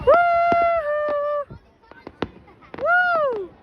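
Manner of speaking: long high "woo"; high cheering whoops; excited whooping. Real, not acted. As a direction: whoop it out at the fireworks. Cheering at fireworks show.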